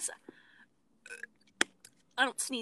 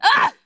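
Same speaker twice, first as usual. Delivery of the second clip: loud high sneeze; sharp shrieking sneeze; 12 semitones higher, 15 dB louder than usual. Shown as a demonstration. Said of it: "It's either like this".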